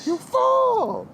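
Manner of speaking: high, shrieked mock insult; mock outraged shout. Scolding co-host for mixing key and tone, mock outraged shriek. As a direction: cry out in exaggerated indignation.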